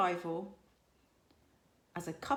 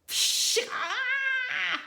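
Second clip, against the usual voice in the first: a hissing spit, then a sharp high "ah"; small dragon hissing in protest; 9 dB louder than usual. A narrator's reading. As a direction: spit it out like an angry little dragon. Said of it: "hissed Toothless".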